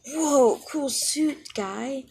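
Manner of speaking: high, loud mock awe; impressed crowd. Classmates gushing over a hero suit, mock awe. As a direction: gush over the suit like a fan.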